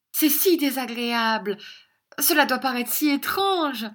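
high, gushing mock distress; affected girlish fuss. A narrator's reading. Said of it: "murmura mademoiselle Squeers".

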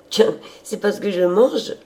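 high, loud, lively retort; exclaiming. A narrator's reading. Sister answering her brother's remark, exclaiming high and loud. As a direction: cry it out brightly as the hearty sister. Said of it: "s'écria-t-elle".

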